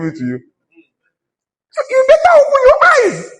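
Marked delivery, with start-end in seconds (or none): 1.7-3.3 very high, loud cry of exhortation; emphatic shouted warning. Real, not acted. Pastor warning singers to find income, high loud shouting. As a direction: shout it high, stretching out the words. Loud admonishing climax to congregation.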